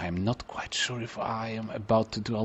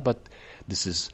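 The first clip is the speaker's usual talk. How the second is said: normal talking voice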